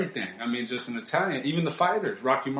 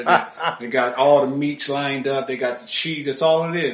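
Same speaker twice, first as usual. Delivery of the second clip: normal talking voice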